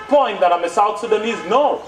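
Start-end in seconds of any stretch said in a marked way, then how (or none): none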